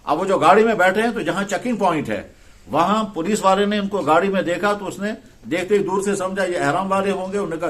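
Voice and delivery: ordinary talk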